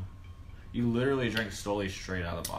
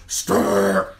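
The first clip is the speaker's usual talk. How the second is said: loud drawn-out growl of the name; henchman's call; 4 semitones lower, 7 dB louder than usual. Retold or imitated. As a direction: imitate the second in command's rough yell. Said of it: "the second in command".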